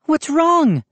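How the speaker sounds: high, sharp question; mother demanding what's wrong. A narrator's reading. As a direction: demand an answer. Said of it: "she demanded".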